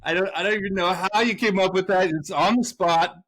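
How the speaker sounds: high-pitched mock amazement; proud dad gushing. Dad praising daughter's pun, gushing high and proud.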